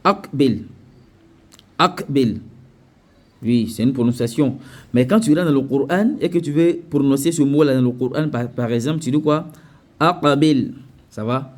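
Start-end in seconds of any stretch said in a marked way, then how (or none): 0.0-2.4 "akbil" with a plain stopped k; without qalqala. Shown as a demonstration. Teacher shows how Arabs say it, without qalqala. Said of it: "les Arabes vont dire". 10.0-10.7 "Aqbil" with q released in a short echo, a-qa-bil; qalqala. Shown as a demonstration. Teacher shows Quran pronunciation, qalqala echo. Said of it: "avec la règle de qalqala".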